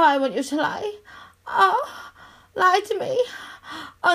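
ordinary delivery

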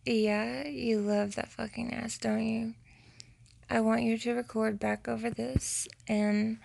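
normal talking voice

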